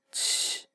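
七 with a strong puff of air, voiceless; 七 breathed out hard, no voice; aspirated qi. Shown as a demonstration. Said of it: "有气无声".

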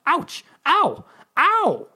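high-pitched acted ouch and ows; yelps of pain. A narrator's reading. Narrator pecked by the baby chick, yelping in pain.

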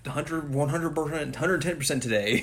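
high, eager runner voice; hyped runner. Retold or imitated. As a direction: pump yourself up like a gung-ho runner. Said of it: "And he's like".

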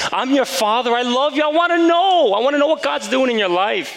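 a father's high, urgent plea; pleading father. Retold or imitated. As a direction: beg him to open up, voice climbing.

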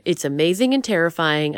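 ordinary talk, nothing marked

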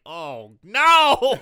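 loud drawn-out wail of dismay; big comic "no" bellow; mock dismay. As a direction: cry out in exaggerated horror. Acts out dismayed reaction to title.

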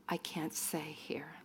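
unvoiced, barely audible whisper. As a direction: whisper it as if afraid to be overheard. Traumatized woman answering in church.

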